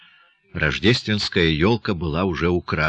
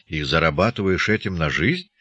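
plain reading voice

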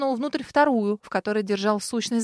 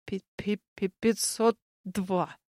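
softer, slow stammer in fear; terrified stutter; 5 dB quieter, much slower than usual. A narrator's reading. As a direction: stammer it out in terror. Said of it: "заикаясь".

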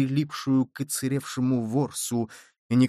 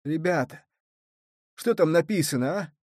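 moaning; 3 semitones higher than usual. A narrator's reading. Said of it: "стонуще спросил".

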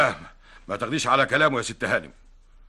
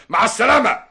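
normal talking voice

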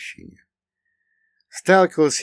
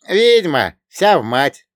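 louder, higher exclamation with a touch of mockery; amused jeer; 7 semitones higher, 8 dB louder, slower than usual. A narrator's reading. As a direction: toss it out as a wry joke. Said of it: "со смешком произнес Богдан". (громко, с лёгкой насмешкой)

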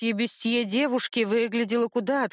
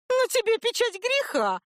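put-on haughty girl voice; 13 semitones higher than usual. A narrator's reading. Haughty girl accuses heroine.

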